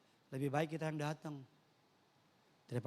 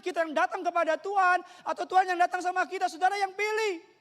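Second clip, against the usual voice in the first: full-force preaching; 12 semitones higher, 10 dB louder than usual. Real, not acted. Preacher urging congregation to church, full-force preaching. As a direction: preach at the top of your voice.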